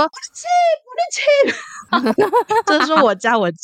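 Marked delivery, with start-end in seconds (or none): 0.0-2.2 retold shout. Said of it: "我就大喊说".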